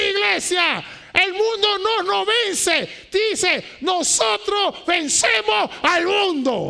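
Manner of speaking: loud, high preaching to the church; full-force preaching. Real, not acted. Pastor preaching hard to his church, loud and high. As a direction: thunder it from the pulpit.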